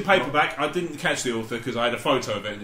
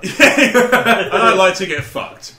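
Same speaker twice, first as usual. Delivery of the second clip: louder, slow, laughing punchline; loud mock line; 6 semitones higher, 10 dB louder, much slower than usual. Finishing friend's character bit, loud and slow. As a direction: bellow the joke.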